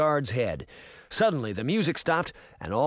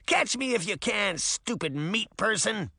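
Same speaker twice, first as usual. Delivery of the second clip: higher-pitched little gingerbread man; cheeky taunt in a raised pitch; crying out tauntingly; 6 semitones higher than usual. A narrator's reading. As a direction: call it out like a tiny troublemaker. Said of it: "he cried".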